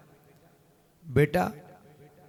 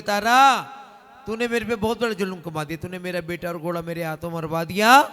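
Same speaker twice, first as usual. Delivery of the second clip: king wailing; 2 semitones higher, 10 dB louder than usual. Acted. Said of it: "राजा रोने लगे, कुरलाने लगे".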